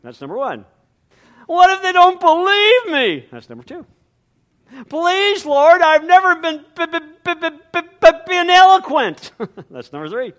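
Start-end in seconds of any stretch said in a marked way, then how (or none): 1.5-3.3 high, loud whining plea; whiny Moses. Acted. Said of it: "he starts to whine". 4.9-9.3 high, loud whine with stutter on b-; whiny stammering Moses. Acted. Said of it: "he starts to whine".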